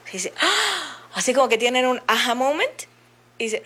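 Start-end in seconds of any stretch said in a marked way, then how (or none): 0.4-1.0 sharp, high mock gasp; acted gasp. Therapist acting out clients' aha moment, sudden gasp. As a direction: gasp as if it just dawned on you.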